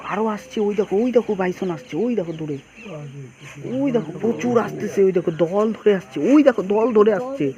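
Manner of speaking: loud, thrilled calling out; excited. Real, not acted. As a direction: point them out at full voice, thrilled. Herd arriving, calls out excitedly.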